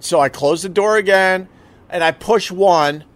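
ordinary delivery

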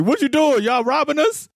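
high, outraged disbelief; high-pitched mock outrage; mock indignant. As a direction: squeak it like you just caught them. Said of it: "I'm like".

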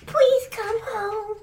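lightly voiced little child, slow; a hint of a pleading small child. Child's postscript in letter.

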